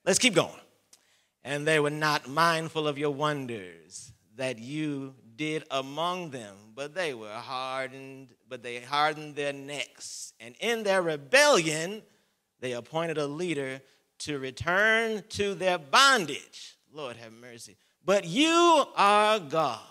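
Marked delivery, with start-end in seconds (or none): 0.0-0.6 louder, higher push forward; rally them onward; urging on. Real, not acted. Preacher pushing the congregation on, louder. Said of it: "urging on". 1.4-16.8 building louder, higher reading; rising scripture reading. Acted. Preacher reading Nehemiah with mounting force.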